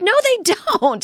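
high, laughing exasperation; exasperated laughing. Retold or imitated. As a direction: laugh out the denial. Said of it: "And I'm like".

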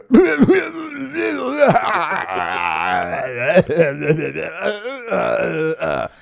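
long, loud, high hacking laugh imitation; smoker's wheezing laugh. Host imitating Gary's laugh on the yacht, a loud hacking cackle. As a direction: cackle like a chimney coughing soot. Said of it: "if a nineteenth century chimney could laugh".

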